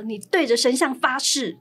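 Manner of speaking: acted-out insistent boss, building in force. Boss making employee swear before a statue, loud insistence. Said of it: "再大声一点说".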